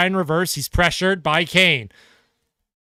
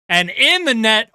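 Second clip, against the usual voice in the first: ordinary talk, nothing marked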